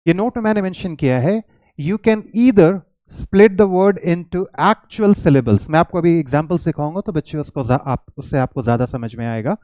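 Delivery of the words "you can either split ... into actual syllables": slow, deliberate dictation of a note; reading notes aloud. Shown as a demonstration. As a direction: read it out word by word for students. Reads note aloud slowly. (धीरे-धीरे note पढ़कर सुनाना)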